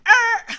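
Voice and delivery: loud high buzzing "Er"; blinker noise. Retold or imitated. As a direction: buzz like an annoying signal. Imitating the blinker sound.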